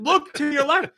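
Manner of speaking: high-pitched mock pleading; exasperated urging. Daughter steering dad toward the dinosaur, exasperated. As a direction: urge him with voice way up.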